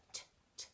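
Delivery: unvoiced T, t tapped softly; whispered t sounds. Shown as a demonstration. Teacher isolating the t sound for children, whispered. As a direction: tap out T, t quietly. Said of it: "Can you hear the sound".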